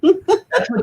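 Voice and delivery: laughing slowly through the words. Real, not acted. Telling a friend about her ex, laughing. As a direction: chuckle it out, amused.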